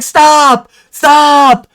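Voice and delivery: high, loud put-on yell of stop; a roommate losing it, high mock shout. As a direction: yell stop twice in a squeaky exasperated voice. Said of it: "the guy's like".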